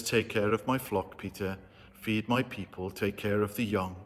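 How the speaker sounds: gentle low voice of Jesus; tender words to a broken Peter; kindly, soft. A narrator's reading. Jesus tenderly charging Peter, softly. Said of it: "Jesus looks so kindly upon him".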